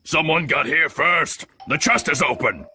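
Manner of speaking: cried out. A narrator's reading. Man at the window telling Pew of the chest, calling out. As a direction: call alarming news down to the road. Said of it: "he cried".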